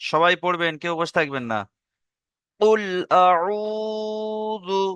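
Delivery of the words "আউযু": আউযু with the আ held one alif, slow and high; stretched vowel. Shown as a demonstration. Teacher drilling the madd. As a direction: hold the first vowel long. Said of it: "একালিপ টান দেবেন".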